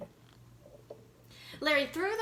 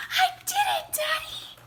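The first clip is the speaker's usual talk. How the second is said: very high, loud triumphant shout; excited shout; 10 semitones higher, 8 dB louder than usual. A narrator's reading. Boy bursting in to tell daddy, ecstatic shout. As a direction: scream the news with joy. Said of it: "he shouted".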